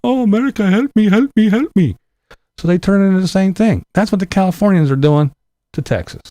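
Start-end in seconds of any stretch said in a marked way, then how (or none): 0.1-2.0 higher, hammed-up begging cry; mock plea for help. Mocking refugees, a hammed-up higher plea for help. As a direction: overplay a desperate plea. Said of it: "that I ran screaming".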